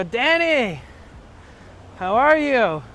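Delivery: put-on friendly old man calling out a greeting; imitating Luis greeting. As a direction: call out his name happily. Luis greets him at van.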